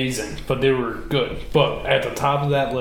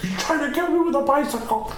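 much higher, squeaky put-on voice, indignant; mock outraged brother. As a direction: squeal the question like an offended man-child. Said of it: "he's like".

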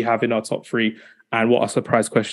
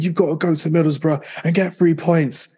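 rough impression of an outburst, pitched up; mock football manager's rant, higher voice; lightly imitated indignant manager; Kevin Keegan outburst; 5 semitones higher than usual. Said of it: "the Kevin Keegan outburst that he had".

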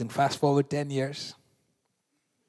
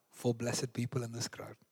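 normal talking voice

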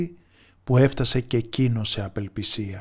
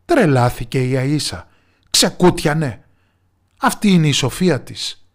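louder, indignant villagers' yell; scornful outcry, louder; crowd shouting; 2 semitones higher, 8 dB louder than usual. A narrator's reading. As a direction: shout her down as a mocking crowd. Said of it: "φώναζαν όλοι".

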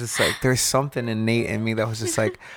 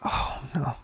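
ashamed dad; 2 semitones higher, 3 dB quieter than usual. Retold or imitated. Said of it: "the dad's all ashamed".

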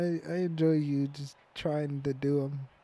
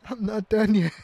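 higher, louder mock reply; mock swan counter; 7 semitones higher, 7 dB louder than usual. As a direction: whine it back like the harried counter. Voicing swan counter answering Queen.

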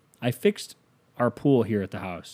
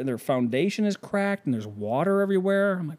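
ordinary talk

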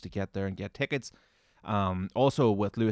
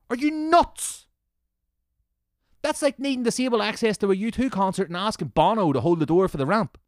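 loud, higher-pitched exasperated tirade; heated, raised-voice comic rant; indignant rant; 5 semitones higher, 6 dB louder than usual. Real, not acted. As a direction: berate them loudly in disbelief. Ranting at the ticket commenter.

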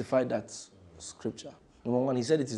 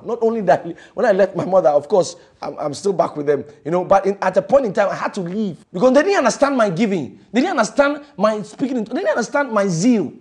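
fiery preaching; 6 semitones higher, 9 dB louder than usual. Acted. Preaching at full force.

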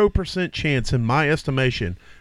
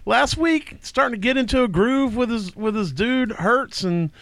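mock excitement; 9 semitones higher than usual. Mocking hype over a player.

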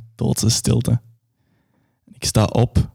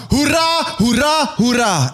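1 crowd cheering; 12 semitones higher, slower than usual. Acted.

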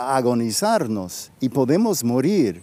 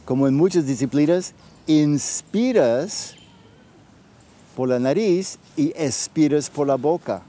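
right in your ear. Acted. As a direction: guide them gently inward, with long pauses. Leads a guided breathing practice.

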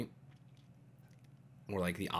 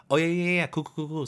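high, rattled-off mock agreement; feigned relating; 6 semitones higher, 4 dB louder than usual. Pretending to relate to country club problems, high mock agreement. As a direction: agree too eagerly, in a string of repeats. Acted fake relating to unrelatable talk.

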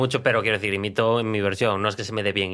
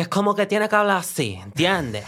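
lightly done Colombian accent, pitched higher; a playful stab at a Colombian accent, pitched up; 7 semitones higher than usual. Shown as a demonstration. Showing his friends a Colombian accent, pitched higher. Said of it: "el acento colombiano".

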